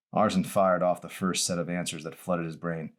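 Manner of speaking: plain reading voice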